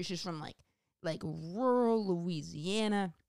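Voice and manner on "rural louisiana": slow Southern twang; Southern drawl on rural Louisiana. Pretend.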